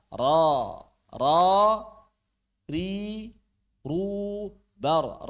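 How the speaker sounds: loud "ro" with the vowel opened, not rounded; open thick ro. Shown as a demonstration. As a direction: drill "ri, ru, bar" slowly, each held apart. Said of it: "Tapi dibuka". ("ro" tebal dengan mulut dibuka)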